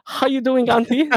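put-on a teasing Filipino accent, light; mock Filipino accent. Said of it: "It sounded Filipino".

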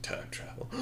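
acted villain whisper, very soft; whispered reveal. Villain revealing his scheme to Dan, stage whisper. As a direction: whisper it as the big secret.

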